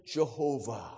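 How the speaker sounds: hushed, low murmur, still lightly voiced; imitated reverent whisper. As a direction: drop right down as if the name is too sacred. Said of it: "whisper the name".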